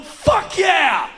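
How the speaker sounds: high, loud whoop; judge's cheering shout. Acted. Judge cheering the wife's confession, loud high whoop. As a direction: celebrate while banging the gavel. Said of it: "the judge would have been banging his gavel going".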